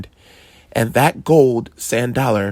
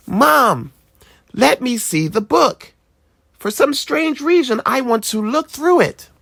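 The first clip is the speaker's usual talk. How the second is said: put-on curious boy voice; a kid eager to see the book; high child voice; 13 semitones higher than usual. A narrator's reading. Boy asking his mom for the book, curious child voice.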